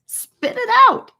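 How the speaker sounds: high, loud impatient holler; a sudden shrill yell. A narrator's reading. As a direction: bark it out, out of patience. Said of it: "nearly lost her patience and hollered".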